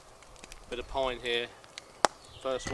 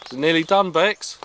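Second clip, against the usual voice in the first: calling out; 5 semitones higher, 10 dB louder than usual. Real, not acted. Calling across camp to partner.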